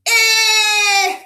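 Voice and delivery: IH held long, high and loud, as in sit; a loud sustained IH for letter I; stretched short I. Shown as a demonstration. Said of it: "One more time".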